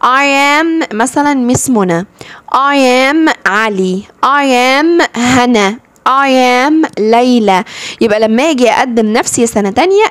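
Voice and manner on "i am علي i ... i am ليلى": slow, deliberate model sentences; نطق بطيء للتوضيح. Shown as a demonstration. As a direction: spell each name out slowly for kids. Teacher models self-introductions. (جمل نموذجية ببطء للتلاميذ)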